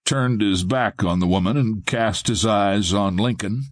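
plain reading voice

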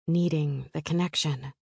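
ordinary talk

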